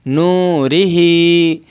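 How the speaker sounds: नूरिही slowly, नू and ही stretched; long vowels. Shown as a demonstration. Teacher modelling correct नूरिही, slow. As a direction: draw out नूरिही evenly. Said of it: "खींच कर पढ़ेंगे".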